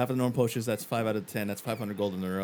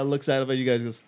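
ordinary talk

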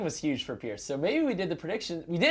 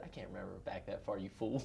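gruff coach; 9 semitones lower, 16 dB quieter than usual. Retold or imitated. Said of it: "He said".

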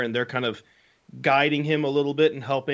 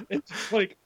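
usual speaking voice

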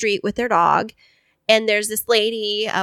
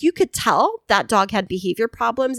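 usual speaking voice

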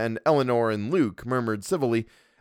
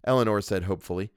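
usual speaking voice

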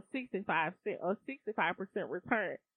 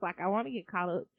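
ordinary talk

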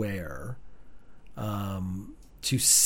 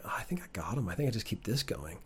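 a sly, softer imagined whisper to himself; 4 semitones lower, 14 dB quieter than usual. Retold or imitated. Suspect under interrogation, thinking to himself, sly whisper. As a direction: whisper it like you've just outsmarted the cop. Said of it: "I'd be like".

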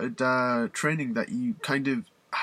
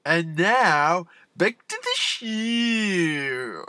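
slow, stretched, pitched-up radio jingle voice; announcer segue; 5 semitones higher, 4 dB louder, much slower than usual. Acted. Host bringing listeners back from the ad break, in a slow stretched announcer voice. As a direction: draw it out like a station ident.

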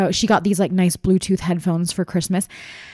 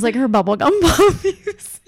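laughing; 5 semitones higher, 4 dB louder than usual. Real, not acted. Laughing while describing.

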